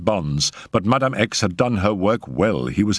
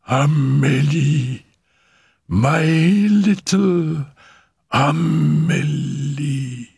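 very slow, high, drawn-out calling; hushed, yearning call; 6 semitones higher, much slower than usual. A narrator's reading. Mother calls to her materializing child, drawn-out yearning. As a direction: stretch each word out, raised in pitch, as if calling a spirit. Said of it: "The hoarse whisper came from Madame X".

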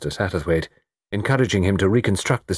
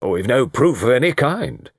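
higher-pitched, weighty colonel's objection; heavy; 6 semitones higher, 3 dB louder than usual. A narrator's reading. Colonel Melrose objects that proof is lacking, heavily. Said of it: "said Colonel Melrose heavily".

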